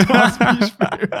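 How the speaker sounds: high laughing words. Real, not acted. Laughing at own example.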